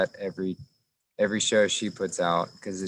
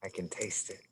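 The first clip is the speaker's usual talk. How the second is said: quiet; 11 dB quieter than usual. Real, not acted. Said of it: "responds to partner, echo game".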